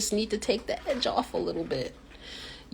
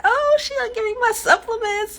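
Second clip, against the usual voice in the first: much higher giddy mock delight; giddy happy. Imitating her happy self after the call, giddy and high. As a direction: squeal with childish glee. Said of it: "I was all happy".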